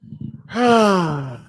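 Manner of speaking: a long, heavy sigh out loud. Real, not acted. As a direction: sigh like the result still hurts. Dejected host before talking.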